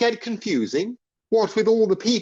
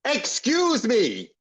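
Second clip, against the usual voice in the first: drawn-out, raised yell for attention; 3 semitones higher, slower than usual. Acted. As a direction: yell it slowly to cut into the chatter. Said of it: "Sorry to yell at my new friends".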